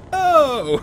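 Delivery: big, loud, high-pitched exclamation; relieved friendly police. Retold or imitated. Police learning they are Canadian, loud delighted realization. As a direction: imitate a sudden delighted recognition, hammed-up. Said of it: "just have to say Canadian? They're like".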